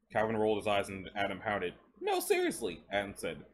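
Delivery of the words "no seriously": put-on high, eager young man asking. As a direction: push up high, curious and insistent. Adam's voice in the story.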